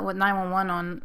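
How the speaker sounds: ordinary delivery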